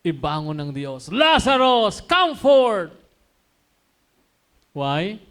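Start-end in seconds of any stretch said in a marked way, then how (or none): 1.1-2.9 loud, high command into the tomb; commanding call. Acted. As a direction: call the dead man out with full force. Jesus calls Lazarus from tomb.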